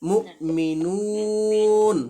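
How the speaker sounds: মুমিনুন with the নু stretched three alif long, stopping on ন; slow recited মুমিনুন, long vowel then a hard stop; stretched stop reading. Shown as a demonstration. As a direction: draw out মুমিনুন slowly, end cleanly on the last ন. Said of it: "তাহলে কি হবে?".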